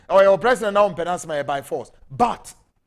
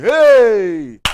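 sudden loud "Hey!" of disbelief; 10 dB louder than usual. Real, not acted. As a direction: throw out a shocked Hey. Exclaims at president's failure.

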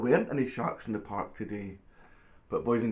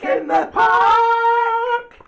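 very high, long, loud holler; storybook shout; 14 dB louder, much slower than usual. Acted. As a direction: stretch the alarm out at full voice. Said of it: "He shouts".

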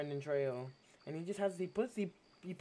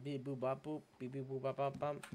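deadpan robot beeping; robot beeps; 3 semitones lower, 5 dB quieter than usual. Pretend. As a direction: beep boop like a robot. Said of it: "If it is a robot".

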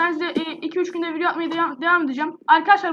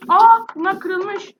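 surprised; 3 semitones higher, 7 dB louder, much slower than usual. Real, not acted. Eggs found broken mid-game.

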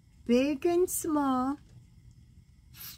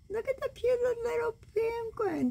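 cooing delight; 12 semitones higher, faster than usual. Real, not acted. Grandma shows grandkids the penguin picture, high cooing delight. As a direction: gush over the penguin.

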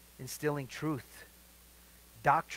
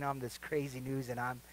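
normal talking voice

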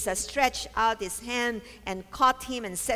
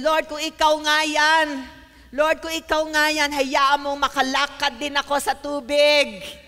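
big, loud, slow bold voice; loud-voiced Peter; 3 semitones higher, 6 dB louder than usual. Peter calling to Jesus on the water, loud-voiced. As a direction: call out boldly, drawing the words out. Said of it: "Medyo malakas ang boses ni Pedro".

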